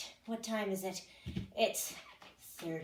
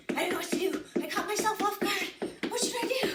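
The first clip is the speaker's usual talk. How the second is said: higher, louder, laughing through the words; flustered laughing; 8 semitones higher, 4 dB louder, faster than usual. Real, not acted. Instructor laughing at herself mid cardio, flustered. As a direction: caught off guard and giggling. Caught off guard mid workout.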